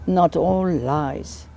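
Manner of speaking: "Not all lies" slow and drawn out; English intonation. Shown as a demonstration. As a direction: stretch each word of "Not all lies" as an intonation exercise. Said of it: "suive les intonations anglaises".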